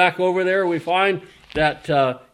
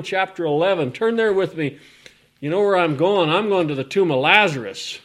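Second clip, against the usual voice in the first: ordinary delivery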